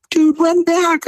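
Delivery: very high, strained mock yell; retold frantic call. Driver hurries his strolling passenger, retold frantic call.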